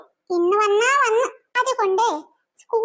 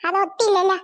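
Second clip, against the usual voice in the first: normal talking voice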